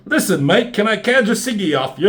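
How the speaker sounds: mock British accent, pitched high and loud; put-on British accent. Character asks for a cigarette, hammed-up British accent. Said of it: "another thing with accents they try and do".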